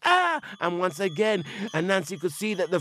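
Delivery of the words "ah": loud high-pitched ah; high spirit yelp. Acted. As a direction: yelp as its leg sticks. Spirit kicks the doll.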